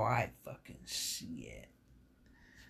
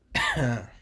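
loud coughing fit; 7 dB louder than usual. Real, not acted. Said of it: "goes down the wrong pipe".

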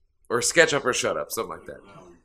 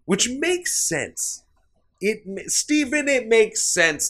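high, slow mock voice; silly high voice; 11 semitones higher, slower than usual. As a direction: stretch it out in a squeaky put-on voice. Mock voice on Tom Cruise.

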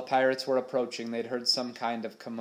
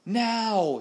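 high, loud battle command; explosive drawn-out yell; roaring; 11 semitones higher, 6 dB louder than usual. A narrator's reading. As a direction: roar the signal to attack. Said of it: "he roared".

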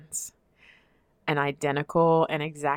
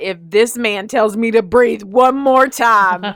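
mock fury; 3 semitones higher, 6 dB louder than usual. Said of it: "I thought".

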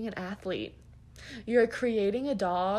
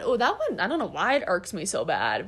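ordinary talk

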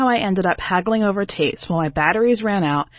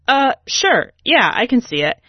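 flustered reply; 6 semitones higher, 4 dB louder than usual. Retold or imitated. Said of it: "and I'm like".

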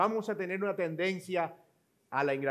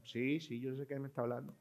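mock weary reply; 3 semitones lower, 9 dB quieter than usual. His bored reply to caller.

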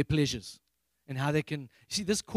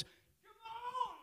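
piercing high yell; crying out; 14 dB quieter than usual. Shown as a demonstration. Preacher showing how the prisoners cried out, a desperate yell. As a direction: cry out with desperate force. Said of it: "That's crying".

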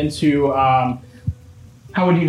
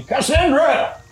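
yelling upstairs; 2 semitones higher, slower than usual. Acted. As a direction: holler the news up the stairs. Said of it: "he yells again".

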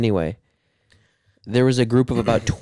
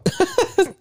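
squeaky high laugh. Pretend. Sound effect joke, wrong sound.

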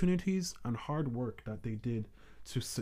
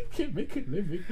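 laughing mock quote; 13 semitones higher, 9 dB louder than usual.

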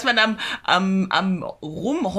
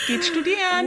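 mock sob; 10 semitones higher than usual. Ironic advice after complaining.

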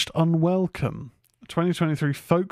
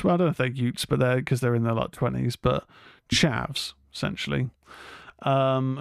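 ordinary delivery

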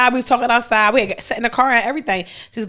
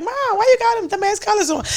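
higher mocking jab; teasing mom; 9 semitones higher than usual. Daughter teasing her mom about her clothes, higher and mocking. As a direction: poke fun at her outfit.

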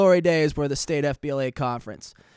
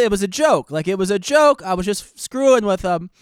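usual speaking voice

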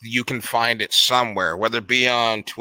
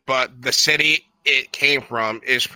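ordinary talk, nothing marked

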